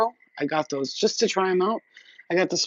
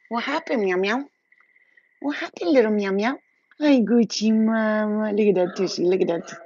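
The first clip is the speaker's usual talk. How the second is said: sing-song pet talk, pitched up; 5 semitones higher, 4 dB louder than usual. Real, not acted. Cooing to her pet.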